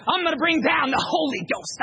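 high, loud fire-and-brimstone shout with a gasp after; raspy preacher. Retold or imitated. Imitating the terrifying preacher to the crowd, raspy full-force holler. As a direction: bellow it as a breathless revival preacher. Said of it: "this real weird raspy tone to his voice".

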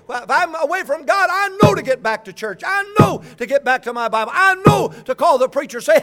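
loud, high shouted sermon; full-force preaching. Real, not acted. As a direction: drive the points home at a preacher's shout.

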